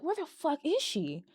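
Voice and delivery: high, hushed voice of confusion; baffled reaction. Retold or imitated. Recalling first watch of a dark scene, high hushed bafflement. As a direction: squeak it softly, totally puzzled. Retells own puzzled reaction.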